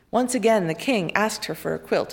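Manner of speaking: ordinary delivery, nothing marked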